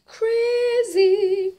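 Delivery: high, loud, drawn-out word; sing-song exclamation. Real, not acted. Vlogger on those who don't like them, high loud sing-song.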